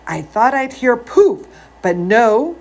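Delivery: ordinary delivery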